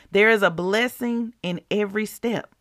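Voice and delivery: ordinary talk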